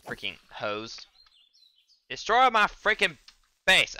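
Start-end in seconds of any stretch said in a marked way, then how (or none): 2.1-4.0 much higher, louder angry yell; furious shout. Real, not acted. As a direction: scream at the mob wrecking the base. Mob wrecks his base.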